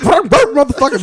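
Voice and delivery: high, loud yappy bark; trash-talking Chihuahua. Acted. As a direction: yap it like a tiny aggressive dog. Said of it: "Chihuahuas talk the most shit".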